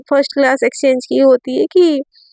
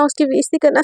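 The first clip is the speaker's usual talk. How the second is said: plain reading voice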